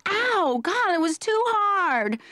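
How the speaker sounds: high, slow whiny ouch; girl hit by a hard pass, in a high whiny voice; dainty whine. Pretend. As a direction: whine like the ball hurt.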